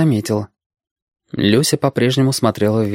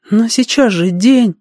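put-on high, slow girl's voice; puzzled girl; 11 semitones higher, 3 dB louder, slower than usual. A narrator's reading. Girl character objects in dialogue. (недоумевающая девочка, высокий голос)

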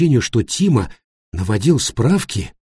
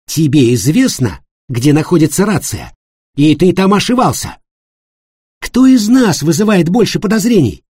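loud, high accusing attack; angry accusation; 7 semitones higher, 5 dB louder than usual. A narrator's reading. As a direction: turn the blame on him. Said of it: "в ярости".

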